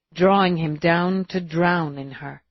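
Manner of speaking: ordinary delivery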